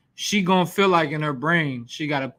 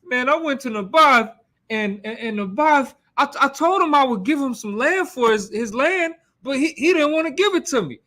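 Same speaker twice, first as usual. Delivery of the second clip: lightly voiced grown king whining like a child, pitched higher; whiny tattletale; 7 semitones higher than usual. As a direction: tattle to his wife like a sulky kid, half-hearted. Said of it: "like tattletelling".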